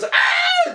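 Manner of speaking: very high mock scream; Leo screaming impression. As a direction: imitate a hysterical shriek of collapse. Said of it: "and just screams".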